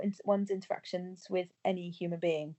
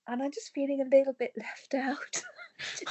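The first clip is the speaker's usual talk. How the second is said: laughing whisper; 5 semitones higher than usual. Real, not acted. Admits feeling left out.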